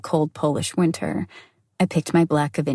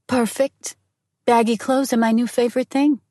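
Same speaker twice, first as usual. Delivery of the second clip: higher, dry sarcastic reply; mock-cheerful sarcasm to her husband on the phone; 6 semitones higher than usual. A narrator's reading. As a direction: sell the sarcasm with a lifted pitch. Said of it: "I replied sarcastically".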